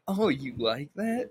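slow, teasing insinuation; mock suggestive family member. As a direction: draw it out as a leering question. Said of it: "I hate when my family does shit like".

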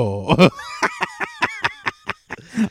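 high, wheezing burst of laughter; real squealing laugh. Real, not acted. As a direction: crack up helplessly at your own bit. Cracks up at own impression.

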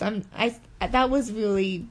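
ordinary talk, nothing marked

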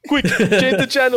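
hammed-up movie line. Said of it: "movie quote, high and slow".

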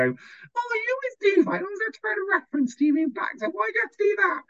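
high, fast, sneering imitation of a nagging friend; mocking whiny friend. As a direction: pitch it way up and rattle it off like a smug know-it-all.

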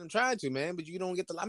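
ordinary delivery

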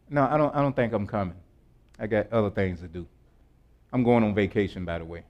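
low, soft, offhand refusal; dismissive brush-off. Acted. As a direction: play the rich snob casually declining. Rich person brushing off an invitation.

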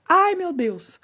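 high-pitched startled cry; imitated fright. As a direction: mimic a teacher scared out of her wits. Imitates startled teacher nearly falling. (susto imitado, gritinho agudo)

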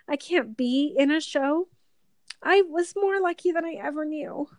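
fighting back tears while speaking; tearful, wistful confession; teary. Real, not acted. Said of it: "the episode of crying".

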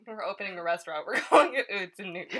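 giggling announcement; joking through laughter; laughing. Real, not acted. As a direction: laugh as you pitch the idea. Joking restaurant plan.

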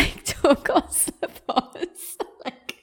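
words squeezed out through real laughter; laughing. Real, not acted. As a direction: laugh helplessly while getting it out. Laughing at own embarrassing mistake.

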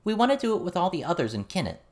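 put-on earnest teen girl. Verona telling John they came to interview him, earnest girl voice. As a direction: state it plainly but young.

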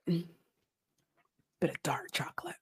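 slow teasing whisper. Pretend. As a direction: breathe it out slowly and secretively. Teasing about chocolate.

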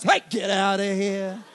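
slow, laughing mock threat at a cat; hammed-up bravado at a passing cat; mock tough guy.

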